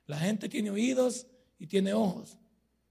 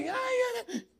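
mock high squeal of his wife; wife's squeaky voice; 14 semitones higher, 3 dB quieter, much slower than usual. Pastor mocking how his wife talks, high squeal. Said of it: "Normalmente mi mujer habla así, una voz".

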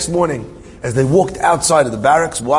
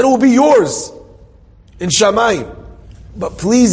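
high, louder, impassioned plea; begging; 8 semitones higher, 3 dB louder than usual. Retold or imitated. Friend gives up heavenly reward, begging. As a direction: act out a desperate last offer. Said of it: "He's begging, pleading".

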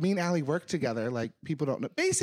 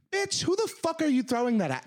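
retold yell; 8 semitones higher than usual. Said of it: "you're heated".

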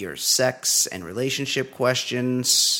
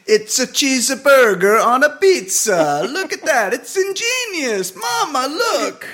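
put-on very high, loud Italian accent; jolly Italian pizza chef; 13 semitones higher, 7 dB louder than usual. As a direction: squeal with delight at Mama. Joins the Italian bit.

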